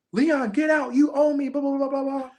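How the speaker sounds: high, urgent mock yell; retold yelling. Player ordering Leon out of the room, mock yelling. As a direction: pitch way up, petering into blah-blah filler.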